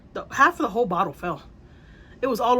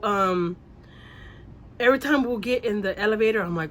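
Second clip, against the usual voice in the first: ordinary delivery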